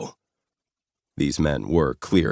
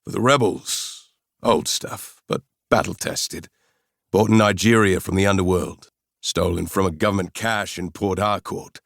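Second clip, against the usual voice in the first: put-on South African accent; 4 semitones higher than usual. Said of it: "With a strong South African accent".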